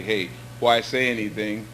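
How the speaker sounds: ordinary delivery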